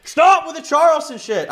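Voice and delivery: loud, very high outburst; exasperated shouting. Real, not acted. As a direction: yell at chat to quit it. Scolding chat for Charleston jokes.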